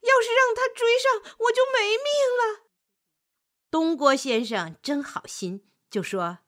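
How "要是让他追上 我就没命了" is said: high, urgent plea for your life; frantic wolf begging for help; panicked pleading. Acted. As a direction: beg desperately in a high, hurried voice.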